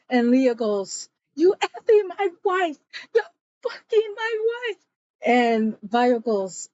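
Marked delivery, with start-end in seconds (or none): 1.4-4.8 higher, half-crying accusation, acted out; mock tearful fury; hammed-up jealous rage. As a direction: play the betrayed husband, voice cracking up high. Accusing friend of affair.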